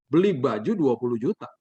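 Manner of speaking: mildly incredulous repeat in a normal register; understated astonished questioning; shocked echo. Retold or imitated. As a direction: echo it back in mild disbelief. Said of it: "saya makin kaget".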